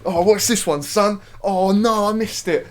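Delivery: loud, high-pitched mock gamer dad; excited dad impression. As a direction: mimic a dad wildly caught up in play. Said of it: "my dad was getting proper into it".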